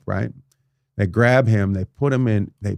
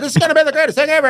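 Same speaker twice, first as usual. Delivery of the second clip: high, fast mock-boastful voice; 5 dB louder, much faster than usual. Cocky builder bragging about his building, mock boast. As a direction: play the cocky developer, pitched way up and rushed.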